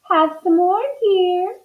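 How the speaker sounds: high-pitched doting wife; dreaming Missus Watson. A narrator's reading. Missus Watson serving toast in her sleep, high dreamy offer. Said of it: "Missus Watson said in her sleep".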